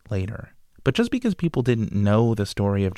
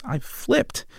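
excited. Real, not acted. Thrilled discovery of history.

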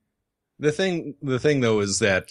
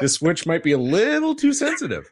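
laughing; 10 semitones higher, 4 dB louder than usual. Real, not acted. Speaking through laughter.

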